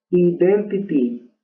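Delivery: "Identity" slowly as ee-den-tee-tee; slow Spanish-style reading of "Identity"; Spanish pronunciation. Shown as a demonstration. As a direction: sound out "Identity" with pure Spanish vowels. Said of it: "Eso es usando todo el español".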